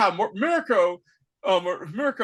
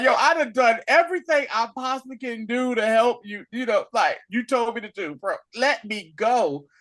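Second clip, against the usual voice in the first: exasperated plea; 6 semitones higher, 3 dB louder than usual. Retold or imitated. Retelling a character pleading to leave.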